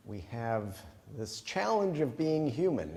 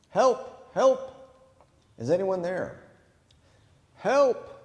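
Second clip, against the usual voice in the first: high, loud calls for help; stranded man crying out for rescue; yelling for help; 8 semitones higher, 8 dB louder, slower than usual. Acted. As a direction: shout it down the canyon to be heard. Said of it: "So Jack began yelling for help".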